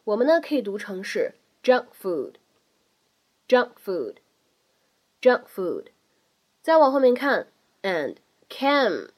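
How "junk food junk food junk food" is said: junk food with the k held, not released, slowly; 不完全爆破; incomplete plosion. Shown as a demonstration. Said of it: "不完全爆破".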